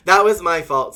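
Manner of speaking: loud laughing apology. Real, not acted. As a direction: laugh through it loudly. Laughing at own mistake.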